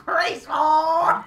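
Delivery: high, loud cartoon shout; laughing, hammed-up battle cry; Cartman. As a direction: bellow it like a cartoon brat. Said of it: "Oh, fucking Cartman".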